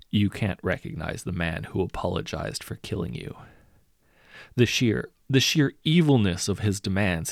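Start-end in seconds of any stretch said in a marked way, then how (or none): none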